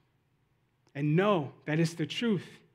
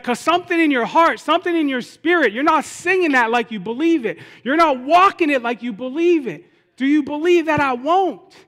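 raised, higher, emphatic confrontation; insistent challenge; 8 semitones higher, 6 dB louder than usual. Retold or imitated. God confronting the doubting believer, forceful challenge. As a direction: press each point home forcefully.

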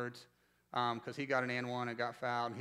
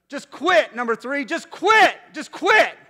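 full-volume yell, pitched way up; heckling shout; 11 semitones higher, 16 dB louder, slower than usual. Retold or imitated. Assistant coach yelling at a rival player, shouting. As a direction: bellow it from the bench, building on each repeat.

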